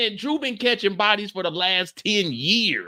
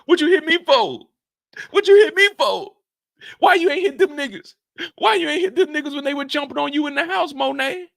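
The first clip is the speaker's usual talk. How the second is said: louder, higher, outraged demand; angry confrontation; 6 semitones higher, 5 dB louder than usual. Pretend. Host voices Drew yelling at Monet over the slap, outraged. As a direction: shout at her for the slap.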